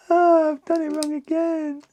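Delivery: high, hammed-up groan of frustration; high mock-frustrated whine; mock exasperation. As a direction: whine it like a cartoon. Frustrated at a building mistake.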